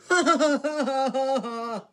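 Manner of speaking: loud, high laughing fit; big hearty laugh. Acted. James laughing at Gordon in the sheds, loud hearty laughter. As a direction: laugh long and hard at him. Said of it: "James laughed and laughed".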